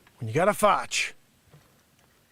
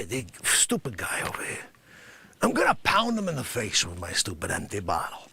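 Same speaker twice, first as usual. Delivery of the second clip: ordinary talk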